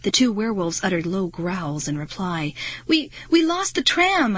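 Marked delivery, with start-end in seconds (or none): 2.9-4.4 higher-pitched, halting explanation; high, nervous child voice; anxious explaining. A narrator's reading. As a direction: stumble into a worried explanation. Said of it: "I explained to Wolf Girl".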